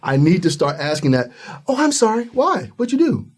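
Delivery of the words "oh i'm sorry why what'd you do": mock puzzled reply. Acting out asking why.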